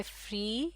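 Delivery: فری said slowly with a long ee; pronunciation demo. Shown as a demonstration. Teacher modelling فری for learners, slow and clear. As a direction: draw out فری, stretching the ee sound. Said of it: "یہ دو الفاظ ہیں".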